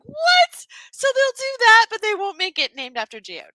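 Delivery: high, incredulous self-quote; indignant disbelief. Retold or imitated. Co-host re-enacting their flabbergasted reaction to the dessert, mock-outraged. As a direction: squeal the first word, then tumble down in pitch. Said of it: "I was sitting there going".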